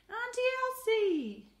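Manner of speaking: loud, high, drawn-out call of delight; joyful yell. A narrator's reading. Boy seeing his aunt arrive, loud high joyful yell. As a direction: shout her name with pure joy. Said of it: "yelled Andy".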